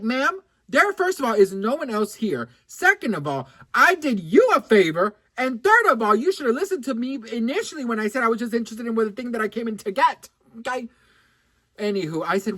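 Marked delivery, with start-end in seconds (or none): 0.0-10.9 punchy, indignant point-by-point scolding; acted telling-off. Customer setting the rude saleswoman straight, indignant scolding. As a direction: lay down each point, mock affronted.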